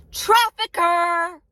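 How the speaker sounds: loud, high, drawn-out cry; accusing shout. Real, not acted. Driver naming what the passenger really is, accusing shout. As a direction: yell the label, stretching it out.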